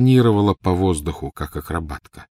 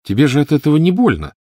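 put-on high, louder little-girl voice; protesting child; 9 semitones higher, 6 dB louder than usual. A narrator's reading. Lily answering her sister's shriek, protesting.

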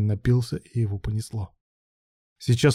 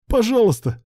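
very high-pitched plea; begging cry; 12 semitones higher than usual. A narrator's reading. Young man begging his lover, crying out high.

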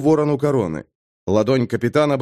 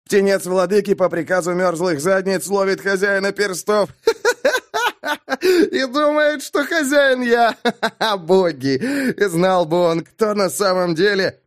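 very high, laughing ridicule; breaking up laughing while jeering; hysterical mocking laughter; 8 semitones higher than usual. A narrator's reading. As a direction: squeal the words between cackles. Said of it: "Бэкфилд согнулся от хохота".

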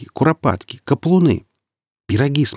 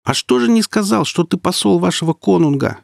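high-pitched raised voice; shouting over noise; 9 semitones higher than usual. A narrator's reading. Marek shouts into Wulfer's ear over the noise. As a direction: call it out over a din. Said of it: "перекрикивая шум, прокричал в ухо".

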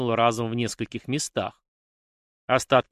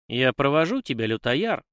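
ordinary delivery, nothing marked

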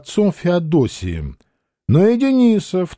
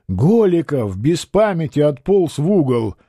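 ordinary delivery, nothing marked

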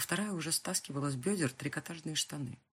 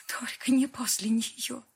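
breathless whispered plea; exhausted girl pleading under her breath; desperate whisper; 9 semitones higher, 6 dB louder, slower than usual. Acted. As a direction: beg him in a whisper. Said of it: "прошептала".

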